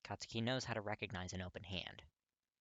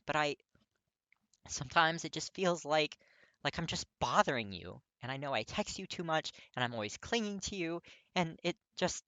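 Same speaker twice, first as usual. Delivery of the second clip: higher and much louder, anxious confession; upset young man; 7 semitones higher, 9 dB louder than usual. A narrator's reading. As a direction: pour out his insecurity, close to tears.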